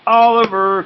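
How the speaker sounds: high loud cry of the name; cried out. A narrator's reading. Esmeralda spotting Oliver coming, loud high cry. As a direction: shout the name in dismay. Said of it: "cried Esmeralda".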